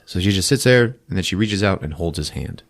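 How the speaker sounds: normal talking voice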